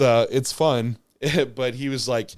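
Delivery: usual speaking voice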